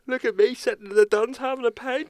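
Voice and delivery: high, loud mocking show-off voice. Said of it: "You don't need to be like".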